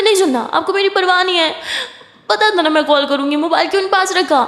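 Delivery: angry scolding. Acted. Girlfriend angrily scolds missed calls.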